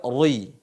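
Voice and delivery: RUI with a heavy, rounded r before i; ri tebal. Shown as a demonstration. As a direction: darken "ri" into "rui". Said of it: "Itu dibaca tebal namanya". (contoh salah: "rui" dibaca tebal)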